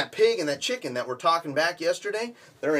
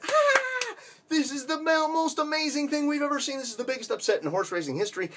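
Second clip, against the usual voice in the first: much higher, gasping laugh of disbelief, faster at the end; amazed crowd; 12 semitones higher than usual. Acted. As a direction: squeal like a stunned spectator. Shocked crowd reacts to upset.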